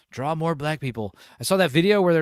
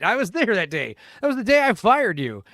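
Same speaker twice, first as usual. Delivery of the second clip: high mock imitation of a boss; imitating character; 6 semitones higher, 5 dB louder than usual. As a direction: parrot the boss line, higher. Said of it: "Yeah, he just said it".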